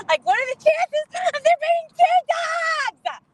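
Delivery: high, rising loud squeal of disbelief; mock incredulous outburst. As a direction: blow up into a high-pitched, laughing-stock cry. Mock outburst about second dog.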